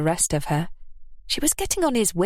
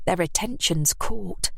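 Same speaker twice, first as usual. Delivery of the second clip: ordinary delivery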